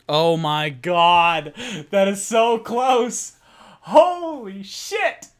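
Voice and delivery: high, loud, slow disbelieving shout; real excited outburst. Real, not acted. GM reacting to a close saving throw, shouting.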